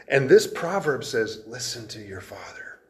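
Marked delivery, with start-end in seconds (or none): 1.5-2.8 soft unvoiced whisper; hushed whisper to a son. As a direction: breathe it like a secret counsel. Proverb's counsel, whispered.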